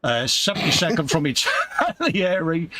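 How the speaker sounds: usual speaking voice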